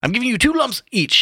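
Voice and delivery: very high-pitched, lively delivery; high character voice. A narrator's reading. Haynes pouring tea for the others, high-pitched voice. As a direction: pitch it right up. First take of Haynes, then corrected.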